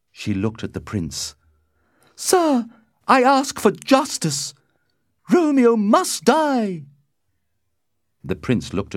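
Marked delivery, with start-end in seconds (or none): 2.1-6.8 very high, loud woman's voice; impassioned demand. A narrator's reading. Lady Capulet appeals to the Prince, high and impassioned. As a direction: demand vengeance, shrieking high, slowing at the end.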